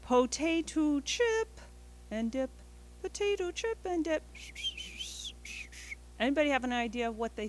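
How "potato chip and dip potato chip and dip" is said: goldfinch call. Shown as a demonstration. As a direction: chirp it like a little finch. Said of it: "sounds a little bit like this".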